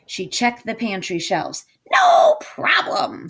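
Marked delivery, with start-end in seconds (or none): 1.9-3.3 very high, slow witch squeal. Acted. Witch ticking off her recipe list, very high and slow. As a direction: stretch it out like a cackling hag. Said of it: "said Gritch".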